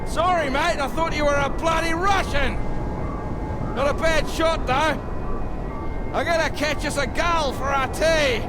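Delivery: put-on carefree drunk bluster. Drunk on whiskey and painkillers.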